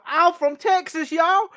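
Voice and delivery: high, hammed-up Texas drawl, imitating a wrestler; teasing a friend with a cartoon Texas accent; mock Texas twang. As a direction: lay on a mocking Texan twang. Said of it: "she comes out there and is like".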